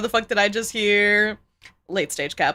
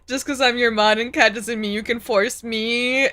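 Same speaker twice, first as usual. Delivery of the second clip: ordinary talk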